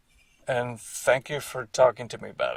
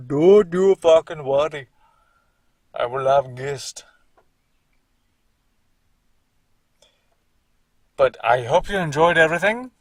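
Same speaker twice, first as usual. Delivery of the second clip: Indian accent; 8 dB louder than usual. Real, not acted. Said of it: "I adopted an Indian accent there".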